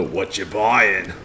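low, slow, drawn-out merchant voice; Resident Evil merchant. Retold or imitated. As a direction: growl it slow like the shady game merchant. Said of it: "That's the Resident Evil four merchant".

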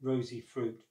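usual speaking voice

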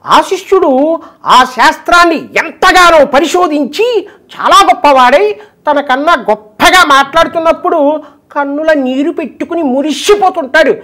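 high, slow pravachanam voice; high measured spiritual discourse delivery; Garikapati impression. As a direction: preach it slowly like the Telugu discourse master. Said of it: "మన గరికపాటి గారు".

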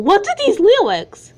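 high, loud disbelief; shocked at the lyrics; incredulous. Real, not acted. Incredulous outburst.

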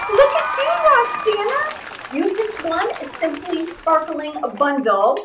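high, drawn-out celebratory cheer; excited winner announcement. Real, not acted. Host telling a viewer she just won, excited high cheer. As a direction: sing out the winner's name slowly and high.

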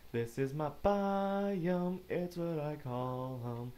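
high, loud, slow proclamation; grand announcement. Acted. Player proudly announcing his home biome, slow and loud. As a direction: draw it out like a grand herald.

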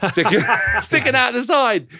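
high, loud, through laughter; laughing punchline. Real, not acted. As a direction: crack up while delivering the payoff.

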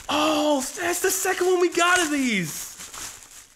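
gasp, then high drawn-out exclamation; real high-pitched excitement; excited outburst. Real, not acted. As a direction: squeal with delighted surprise, slowly stretched. Excited at opening a repeat gift.

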